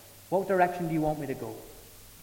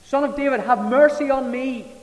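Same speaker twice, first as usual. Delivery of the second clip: loud, high desperate cry for mercy; shouted plea; 8 semitones higher, 6 dB louder than usual. Retold or imitated. Blind beggar shouting to Jesus over the crowd, loud pleading cry. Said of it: "he starts to shout out".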